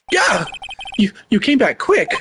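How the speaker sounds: high, startled stammer; flustered yelp, pitched high. Acted. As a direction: jump as if caught snooping. Caught snooping by returning bellboy.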